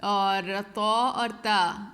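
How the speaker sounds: طا thick, then تا thin, both slow; heavy and thin. Shown as a demonstration. Teacher contrasting طا and تا for students, slow. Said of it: "تا پڑھنی ہے تو باریک پڑھنا ہے".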